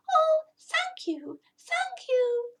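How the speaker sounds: very high, slow, near-tearful thanks; grateful cat. Acted. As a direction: gush thanks with relief. Rescued cat thanks rabbit.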